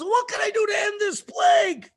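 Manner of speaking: very high, desperate cry; desperate Pharaoh. Acted. Pharaoh begs Moshe to end the plague, high and desperate. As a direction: beg loudly for a way out.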